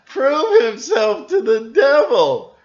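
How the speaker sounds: loud sarcastic disbelief; mocking outburst. Real, not acted. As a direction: scoff at the story loudly. Mocking the story's absurdity.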